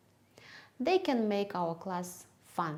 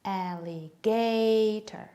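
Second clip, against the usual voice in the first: "Alligator" stretched slowly, long loud "GA"; rubber-band stress demo; 3 dB louder, much slower than usual. Shown as a demonstration. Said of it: "you can use rubber band method".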